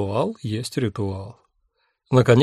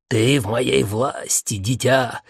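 slow menacing villain voice; cartoon villain voice; slower than usual. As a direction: gloat like a cartoon maniac. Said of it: "увлёкшись ролью маньяка".

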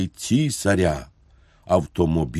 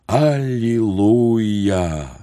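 very drawn-out, mildly raised cry; drilled shout; 3 semitones higher, much slower than usual. A narrator's reading. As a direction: stretch it out like recruits chanting on command. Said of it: "Їх вчили кричати". (протяжний стройовий вигук)

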